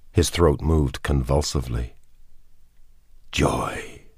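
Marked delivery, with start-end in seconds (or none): 3.3-4.1 mumbled. Acted. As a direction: breathe the word out in a weak whisper. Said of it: "he mumbled".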